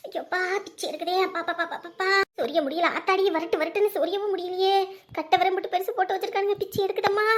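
soft whimpering moan; muted wailing from unbearable itch; itching agony. Acted. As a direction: whine in rapid helpless cries. Moaning over unreachable itch.

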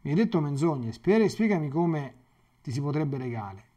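ordinary talk, nothing marked

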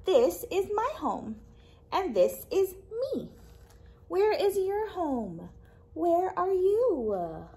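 lively, expressive storytime read-aloud; reading to children. Acted. Librarian reading a picture book to kids, lively storytime voice.

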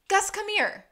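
high-pitched urgent summons; calling out. Acted. Sean calling Gus over to sniff, urgent call.

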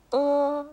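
high, held "うー" like the sound made when gargling; gargle-like e vowel. Shown as a demonstration. Teacher explaining the tricky vowel, throaty held "うー". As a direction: say o with an e mouth as "うー". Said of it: "うがいするときに".

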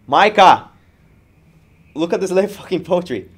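loud, high shout up ahead; scream. Acted. Rider calling to his friend over a truck, screamed. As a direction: holler it to the rider in front. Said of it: "I scream up to Micah".

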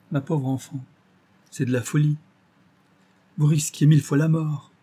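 exclaim, deeply moved; moved outcry. A narrator's reading. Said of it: "m'écriai-je, profondément ému".

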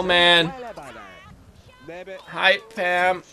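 ordinary delivery

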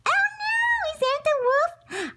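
put-on squeaky little girl, worried; high girl voice. Little girl frightened by the wolf, high and squeaky. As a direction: pitch it way up like a small girl.

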